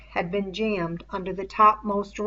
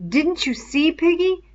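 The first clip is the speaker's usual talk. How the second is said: higher, louder, feverishly eager; feverish excitement; 10 semitones higher, 6 dB louder than usual. A narrator's reading. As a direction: push the question out with a touch of fever. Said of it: "a kind of feverish excitement in his voice".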